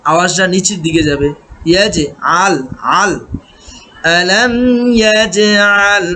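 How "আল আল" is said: ordinary talk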